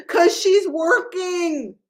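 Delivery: laughing through the words, slow. Real, not acted. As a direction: giggle the line out. Laughing about the psycho manager.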